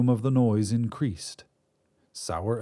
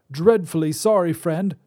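put-on higher reedy old man's voice; reedy old wizard; 7 semitones higher, 5 dB louder than usual. Wizard voice held.

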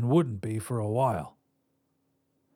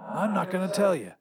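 higher-pitched raspy voice; 7 semitones higher than usual. A narrator's reading. Visitor wakes his past self, raspy. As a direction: rasp it out as an older double of yourself. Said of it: "though a bit raspy".

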